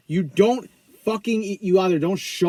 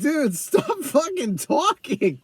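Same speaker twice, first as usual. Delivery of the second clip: high, drawn-out exasperated plea; fed up, stretching the words high; 8 semitones higher, much slower than usual. Acted. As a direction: beg a rambler to shut up. Exasperated retort to rambling character.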